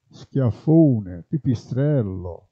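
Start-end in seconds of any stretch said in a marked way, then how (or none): none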